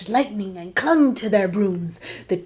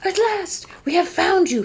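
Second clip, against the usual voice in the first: smiling monster; 10 semitones higher than usual. Acted. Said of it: "he said with a smile".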